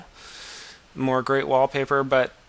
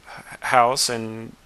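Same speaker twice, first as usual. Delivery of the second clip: normal talking voice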